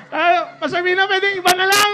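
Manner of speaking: high, loud whiny plea with a laugh; mock pleading. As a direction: beg to be let off, half-laughing. Pleading to avoid assignment.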